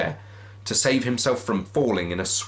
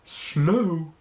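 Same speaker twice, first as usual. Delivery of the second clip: ghostly wail; 7 semitones higher than usual. Acted. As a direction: intone it like a phantom. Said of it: "the ghost repeated".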